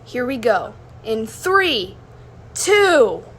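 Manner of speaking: rising loud excited countdown. Acted. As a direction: build up to the launch. Countdown before ride.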